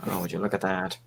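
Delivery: low, soft, proper English gentleman; Sherlock Holmes voice. Acted. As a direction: play the polite English butler, hushed. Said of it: "started talking like this".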